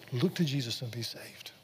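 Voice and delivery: soft, breath-led understatement of a preacher's line; hushed retelling. Retold or imitated. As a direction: softly quote it, nearly whispered. Quiet restatement of the shouted line.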